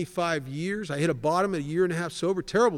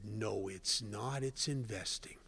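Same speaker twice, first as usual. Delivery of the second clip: sly bad inner voice; 7 semitones lower, 10 dB quieter, slower than usual. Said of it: "The bad voice said".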